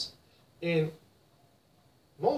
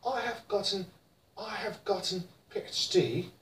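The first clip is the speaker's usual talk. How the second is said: British gotten, much softer, crisp t; British accent; 10 dB quieter than usual. Shown as a demonstration. Teacher models British gotten: British accent. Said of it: "In British".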